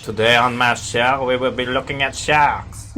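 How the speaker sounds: lightly voiced French documentary host; Jacques Cousteau. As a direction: announce it in a mock French accent. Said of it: "Not Cousteau".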